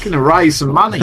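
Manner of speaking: high-pitched mock Australian; badly faked Aussie twang; bad Aussie accent. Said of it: "I think we've done a bad job there".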